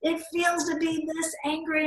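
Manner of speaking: angry woman mirroring the speaker; imitating her anger. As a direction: echo her rage back at her. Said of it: "she's mirroring me".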